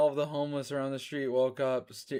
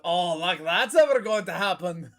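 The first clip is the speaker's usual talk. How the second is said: put-on high, loud dismissive old turtle; mock old sage voice; Gerson voice; 4 semitones higher, 6 dB louder than usual. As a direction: brush it off with a chuckle. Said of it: "Gerson voice".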